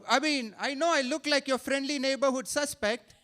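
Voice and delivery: ordinary talk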